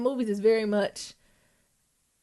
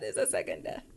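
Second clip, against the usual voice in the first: stunned hush; 12 dB quieter than usual. Retold or imitated. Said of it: "I said".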